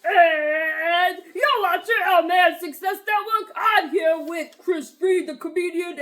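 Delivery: loud, drawn-out host intro; show-opening announcer. Acted. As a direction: kick off the show big and slow.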